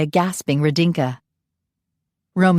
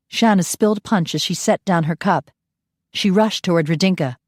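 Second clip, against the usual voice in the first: plain reading voice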